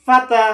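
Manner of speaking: ফাতা said high and loud as one word; teacher's emphatic model reading; blended reading. Shown as a demonstration. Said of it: "দুইটা আমরা একসাথে করে দিব".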